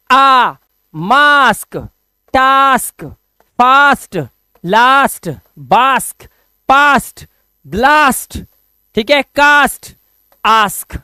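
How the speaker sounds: each word said slowly with a long open "आ", as in "फास्ट". Shown as a demonstration. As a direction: drill the words one by one, stressing the "आ". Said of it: "अब करना क्या है?". (हर शब्द में लंबा खुला "आ" साफ़ बोलें)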